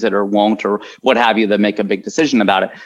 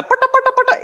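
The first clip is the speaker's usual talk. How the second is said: high-pitched nonsense syllables mimicking an Indian accent; mock Indian accent; faster than usual. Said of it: "the Indian act".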